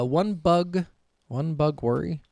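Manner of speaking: ordinary delivery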